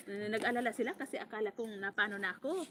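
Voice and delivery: ordinary talk, nothing marked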